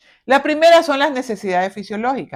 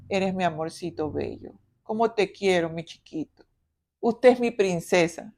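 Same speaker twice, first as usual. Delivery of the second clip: much softer, cooing sweet-talk; doting baby talk; 5 semitones lower, 7 dB quieter than usual. Shown as a demonstration. Mother doting on her little one, soft cooing tenderness. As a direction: coo over the child tenderly. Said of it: "Es diferente a".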